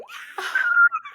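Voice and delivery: very high, loud imitated squeal; excited scream. Mum reacting to engagement news, mock excited scream. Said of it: "like, screaming".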